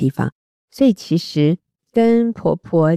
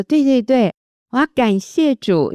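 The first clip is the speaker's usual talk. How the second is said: higher-pitched, eager retold agreement; lifted spirits; 7 semitones higher than usual. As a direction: imitate a friend suddenly cheering up. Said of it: "整个心情被提起来". (模仿心情振奋起来的语气)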